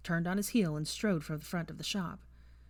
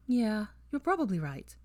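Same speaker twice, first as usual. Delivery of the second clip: apprehensive; 4 semitones higher than usual. A narrator's reading. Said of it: "she yielded apprehensively".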